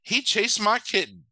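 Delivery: high-pitched put-on complainer; Miss Mittens. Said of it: "And she's like".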